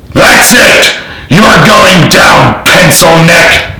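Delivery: high, slow enraged scream. A narrator's reading. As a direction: bellow the threat, out of control. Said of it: "Henderson screamed".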